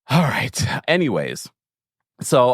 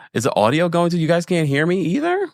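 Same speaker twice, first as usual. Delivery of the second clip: ordinary delivery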